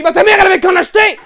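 loud, high mocking yell with a laugh; mocking shout. Rich kid jeers at the poor boy, loud mocking yell. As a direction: jeer it out loud.

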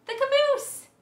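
high, loud reveal; excited answer. Real, not acted. Teacher to kids, excitedly revealing the answer. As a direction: announce it with delight.

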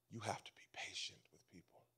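hushed whisper for emphasis. Real, not acted. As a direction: drop to a breathy whisper to draw them in. Preacher drops to sudden whisper.

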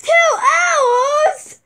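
high shocked outcry. Acted. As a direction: cry out in disbelief.

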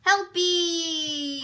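high, loud delighted yell; excited shout. Real, not acted. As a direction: cheer the name. Excited about new toy.

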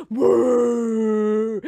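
loud held bellow; mock bawl. A narrator's reading. Storyteller as Moncho in a tantrum, bawling. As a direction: bawl on one long note.

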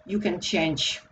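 normal talking voice

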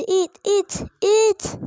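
high, loud eager cheer; excited yell. Real, not acted. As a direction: shout it on. Urging cart, peak.

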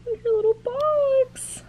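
high, slow pet-talk coo; cooing at the cat. Real, not acted.

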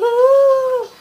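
high, loud drawn-out ooh; mock impressed ooh. A gawker admiring the Heelys with a loud high ooh. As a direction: coo at the shoes like they're amazing. Reacting to Heelys wheels popping out.

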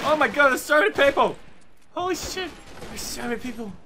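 high, loud burst of alarm. Real, not acted. Gamer to teammates about swarming enemies, alarmed and high. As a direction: cry out in panic, pitched high. Startled by many enemies in game.

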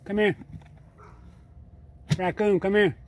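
high, coaxing call to an animal. Real, not acted. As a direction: call the raccoon over, high and bright. Calling a raccoon over.

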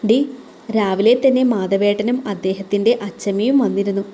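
ordinary delivery, nothing marked

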